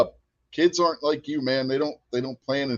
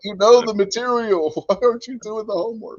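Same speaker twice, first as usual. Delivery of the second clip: imitated exasperated teacher, pitched up; higher, mock-frustrated appeal to a pupil; 7 semitones higher than usual. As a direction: act out a teacher at wit's end. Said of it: "she used to get so frustrated with me".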